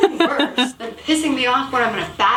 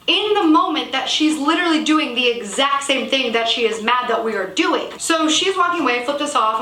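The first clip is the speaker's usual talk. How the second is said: normal talking voice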